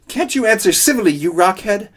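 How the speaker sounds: loud, fast, high snapping; high-pitched furious yelp; angry outburst. Acted. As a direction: lose your temper at the rock. Coyote snaps at the rock.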